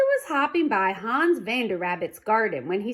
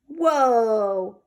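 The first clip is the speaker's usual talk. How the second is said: surprised whoa; 6 dB louder than usual. A narrator's reading. Hoppy startled by mechanical egg.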